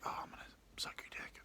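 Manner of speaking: quick, hushed come-on; fast put-on whisper; whispered dirty talk. Girl's dirty talk retold.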